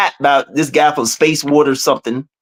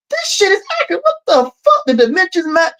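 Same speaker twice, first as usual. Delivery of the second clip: astonished; 12 semitones higher than usual. Retold or imitated. Retelling the check of the equations, astonished. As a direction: pitch leaping very high in disbelief.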